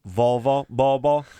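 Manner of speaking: first Volvo with v, second Volvo as Bobo; V swapped to B. Retold or imitated. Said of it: "You can say the V".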